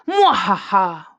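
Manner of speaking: loud, slow villain cackle; evil laugh. Acted. Pete the evil penguin gloating over his plan, hammed-up evil laugh. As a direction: ham up a cartoon villain's triumphant laugh.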